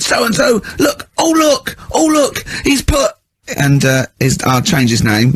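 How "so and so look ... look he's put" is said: high, whimpering outrage; mock wailing teacher. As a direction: whine as if near tears. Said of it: "he went".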